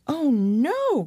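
put-on high girlish cry of dismay; a young girl crying out in alarm; high worried wail; cried out. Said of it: "cried Annalise".

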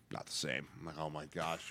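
very soft, low grumble; exasperated aside. Retold or imitated. Retelling restaurant fussiness to podcast co-hosts, exasperated aside. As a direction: drop the voice right down, exasperated.

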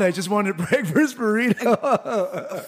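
high, drawn-out protest through laughter; laughing imitation of a friend's excuse; mock-innocent protest. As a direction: plead innocence while cracking up. Acting out Frank's innocent excuse.

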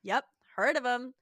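lightly voiced Carly, offhand; casual Carly quote. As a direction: toss it off like a bored friend. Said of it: "Carly's like".

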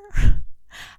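a short bright burst of laughter at herself; amused laugh. Real, not acted. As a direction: laugh it off, amused at her own mix-up. Laughs at own confusion.

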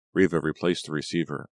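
plain reading voice

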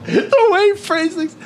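very high, drawn-out falsetto mimicry; teasing falsetto imitation; mocking echo. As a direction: parrot it back slowly in a squeaky high voice. Mimicking co-host's words back, teasing.

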